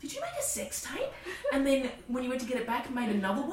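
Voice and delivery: high, gleeful gossip voice; nosy emailers. Retold or imitated. Strangers emailing her, high and gleeful. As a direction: quiz her like scandal hunters.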